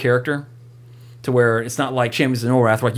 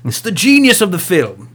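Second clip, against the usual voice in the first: mock film critic; 7 semitones higher, 4 dB louder than usual.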